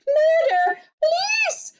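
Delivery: very high, loud shrieks; screamed. A narrator's reading. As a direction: scream each word in panic. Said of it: "screamed Missus Globe".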